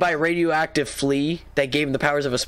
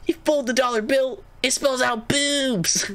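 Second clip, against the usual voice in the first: high, eager conspiracy show host; conspiracy theorist; 8 semitones higher than usual. Retold or imitated. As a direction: pitch it up like a paranoid TV host. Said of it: "the first thing we see is".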